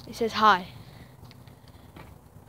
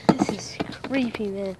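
nervous night vlog whisper; 4 semitones lower, 7 dB quieter than usual. Real, not acted. Creeped out by green ooze.

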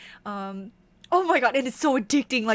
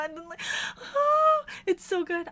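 happy crying; 7 semitones higher than usual. Real, not acted. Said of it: "I did not expect to cry".

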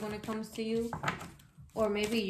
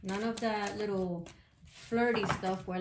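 usual speaking voice

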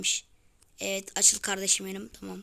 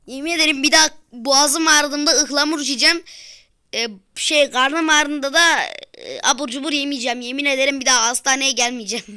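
melodramatic vows; 3 semitones higher, 10 dB louder than usual. Pretend. Climbing out, swearing vows.

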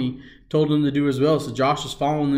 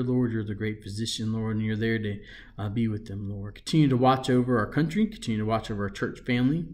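soft, earnest prayer voice, often softer; closing prayer; 3 semitones lower, 4 dB quieter than usual. Acted. Pastor praying aloud for church families and storm victims, soft and earnest. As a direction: pray gently over the families.

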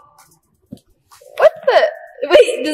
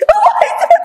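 much higher, louder surprised cry of oh my God; shocked squeal at what she touches; startled shriek; 3 dB louder, faster than usual. Real, not acted. Feeling a strange face.

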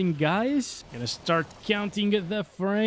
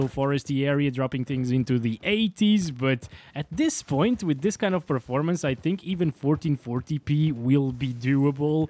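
ordinary delivery

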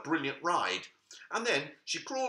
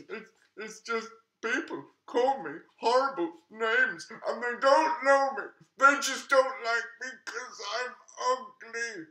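higher, wounded little-monster voice; a lonely monster pouring out hurt; sad monster; 8 semitones higher, 4 dB louder than usual. Acted. Sad monster answers Khadija.

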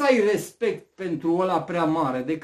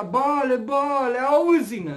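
mock frantic shouting; 6 semitones higher, 5 dB louder, slower than usual. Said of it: "strigau cât îi ținea gura".

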